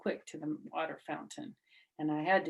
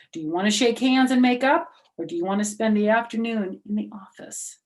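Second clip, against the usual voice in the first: louder, fast, firm teacher; stern ultimatum; 5 semitones higher, 10 dB louder, faster than usual. Acted. Teacher gives the girls a stern choice. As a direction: give them the choice sternly.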